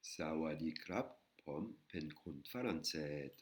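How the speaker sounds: slow Thai demo "Sawadi krap, pom pen kun faranset"; careful slow Thai model reading; Thai sentence. Shown as a demonstration. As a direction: read "Sawadi krap" slowly with Thai tones, rising on krap. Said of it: "Première phrase".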